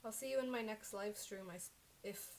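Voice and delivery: ordinary talk, nothing marked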